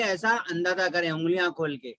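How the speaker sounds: ordinary delivery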